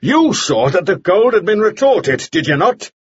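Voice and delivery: high-pitched forceful questioning; cross-examine him at full voice. A narrator's reading. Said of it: "continued without lowering his voice".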